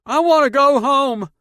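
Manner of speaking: put-on high, loud child's wail; whiny complaint. A narrator's reading. Boy in the car wanting home, loud high wail. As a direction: wail it like a scared kid. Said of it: "Donovan complained in his down-home accent".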